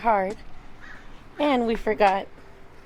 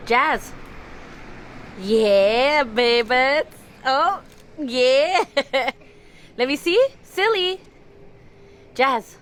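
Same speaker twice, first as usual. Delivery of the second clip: calling from far away; 5 semitones higher, 9 dB louder, much slower than usual. Real, not acted. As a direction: call her name across the yard. Calling baby for photo.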